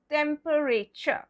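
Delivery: TEMPERATURE in four full syllables, tem-per-ay-cher; spelling pronunciation. Shown as a demonstration. Teacher shows the wrong spelling-based way, TEMPERATURE as tem-per-ay-cher. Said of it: "if we go by the spellings".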